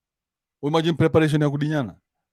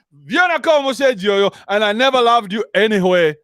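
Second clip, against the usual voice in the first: higher, louder careless farewell; a husband walking out on his family, raised and loud; mock husband leaving; 6 semitones higher, 4 dB louder than usual. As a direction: toss it off like he's already gone. Husband dumping wife for Zari.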